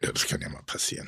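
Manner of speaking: breathy whisper, almost no voice. Real, not acted. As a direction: whisper it dryly, like a sarcastic aside. Mocking the host's excuse.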